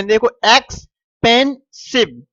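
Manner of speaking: "Expensive" split into three slow beats; teacher spelling out "expensive" in slow beats; syllable by syllable. Shown as a demonstration. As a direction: say "expensive" slowly, pausing between each syllable. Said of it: "इसमें आपके तीन बार रुका जा रहा है".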